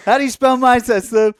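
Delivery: high, loud taunting shout; loud playful demand; mock yell. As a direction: yell it at him, laughing. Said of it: "and I was like".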